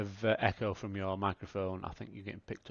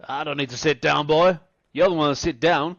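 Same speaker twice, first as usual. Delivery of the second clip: loud, higher, belligerent bar-room bellow; drunk brawler's taunt; 4 semitones higher, 14 dB louder than usual. Acted. Drunk Bixler bellows at Miles to sit down, loud and mocking. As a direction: shout him down like a drunk picking a fight.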